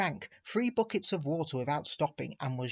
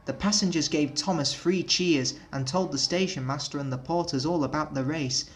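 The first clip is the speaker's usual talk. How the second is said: plain reading voice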